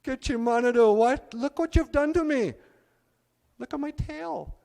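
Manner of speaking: high, loud wailing complaint; crying out. Acted. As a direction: wail to the Great Spirit. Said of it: "he starts to cry".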